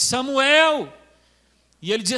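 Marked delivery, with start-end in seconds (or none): 0.0-0.9 loud divine summons; resounding call to a sleeper; God calling. Acted. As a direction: call the name out louder, as God. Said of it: "Deus chamava novamente".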